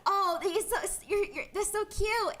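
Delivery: mock flustered guy. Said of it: "he said".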